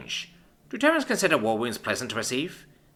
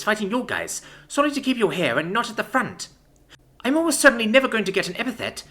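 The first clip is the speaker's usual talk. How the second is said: bitter rage; 11 semitones higher than usual. A narrator's reading. Enraged commander's rant.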